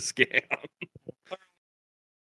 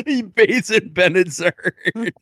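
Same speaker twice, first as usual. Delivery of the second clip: high, slow, laughing through it; 9 semitones higher, slower than usual. Real, not acted. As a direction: say it while cracking up. Laughing while saying it.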